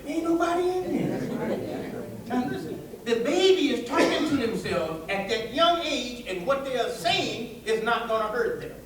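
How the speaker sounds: normal talking voice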